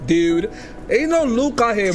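ordinary talk

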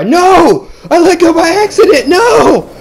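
high loud cry of dismay; panicked shout. Real, not acted. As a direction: yell it as the grip slips. Player loses grip, falls.

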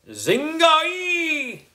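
very high, long held magic word; hollered spell. A narrator's reading. Boy wizard hollering the magic word, very high held call. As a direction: holler it out for fun. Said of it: "I hollered one more time".

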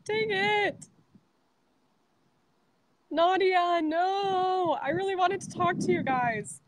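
high, frustrated letdown; dismayed as the call drops; disappointed. Real, not acted.

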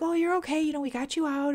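high, cooing comfort; mock reassurance. Scanner staff reassuring a frightened patient, imitated cooing. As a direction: soothe a panicking patient in a high sing-song.

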